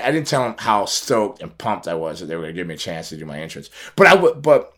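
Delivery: ordinary delivery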